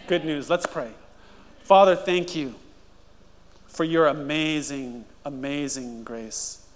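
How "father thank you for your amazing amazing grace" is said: soft, reverent pastoral prayer; quiet heartfelt prayer over music; earnest prayer. Acted. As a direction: pray aloud over the congregation, hushed. Leads a prayer.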